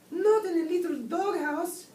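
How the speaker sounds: high, loud, drawn-out kitten voice; shocked exclamation. A narrator's reading. Skipito hears where the Bobolito was, high shocked exclamation. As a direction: squeal it slowly in dismay. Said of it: "exclaimed Skipito".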